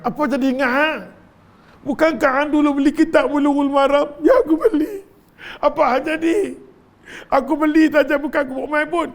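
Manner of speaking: high, teasing voices of the damned questioning; mocking interrogation. Hell dwellers taunting the man who bought the book, mocking. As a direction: jeer at him, then answer sheepishly.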